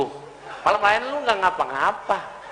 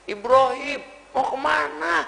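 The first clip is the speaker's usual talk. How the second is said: higher, drawn-out imitated call; teriakan tiruan; 6 semitones higher than usual. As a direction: call after him, stretching the endings. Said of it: "Siti Hajar teriakan tiga kali teriak". (seruan bernada tinggi yang ditarik)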